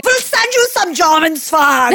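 hammed-up German accent offering swag. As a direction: toss it off in a playful German accent. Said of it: "my best German accent".

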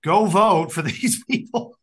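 slow, high mock command; bossing them to vote; mock order. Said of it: "So they get told".

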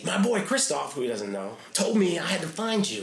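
eager retold pitch. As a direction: sell yourself like an excited kid. Eager fan pitching himself.

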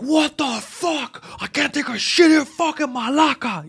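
high, slow furious yell; angry yelling. Retold or imitated. Man bursts out of bathroom at kicking woman, furious yell. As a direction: rage at being disturbed. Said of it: "He's yelling".

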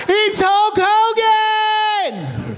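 high, slow drawn-out mock yell; wrestling shout. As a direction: ham up the wrestling cry through a laugh.

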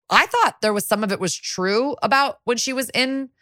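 half-playing the detective's doubt; acting as Rollins. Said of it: "fully acting".

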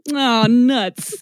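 deadpan victim. Retold or imitated. Skeleton-hand guy's flat reaction.

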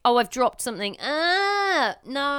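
high mock whine over a dropped thing; whiny baby. Mimicking her baby's whining.

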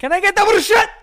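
high, loud hammed-up Italian order; mock Italian accent. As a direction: overdo the Italian on bruschetta.

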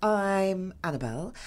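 usual speaking voice

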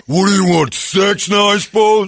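man doing a gruff woman's voice; girl voice. Retold or imitated. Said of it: "That's a solid girl voice".

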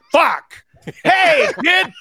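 high, loud gruff greeting; Midwestern dad. Retold or imitated. A Midwestern dad greets his son's friend, loud and high. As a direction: bellow at the kid like a dad. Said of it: "and be like".

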